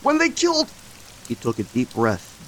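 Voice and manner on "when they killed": panicked cry breaking off. Acted. Derek recalling his death to Josiah, high panicked break. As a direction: push it out then lose your breath. Breaks off before deep breath.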